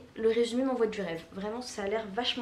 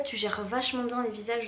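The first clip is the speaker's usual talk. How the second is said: ordinary talk, nothing marked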